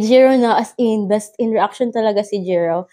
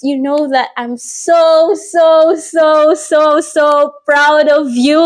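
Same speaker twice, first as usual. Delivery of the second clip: gushing pride; 5 semitones higher, 3 dB louder, much slower than usual. Retold or imitated. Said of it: "sabi ko".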